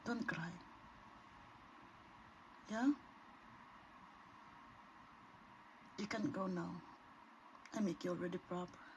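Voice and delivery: soft, gentle reassurance to a small bird; coaxing a frightened bird, softly; soothing softly. Real, not acted. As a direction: hush it kindly so it stops crying. Soothing bird before release.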